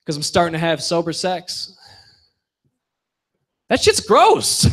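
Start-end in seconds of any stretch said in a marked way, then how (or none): 3.7-4.7 high, grossed-out squeal; disgusted. Real, not acted.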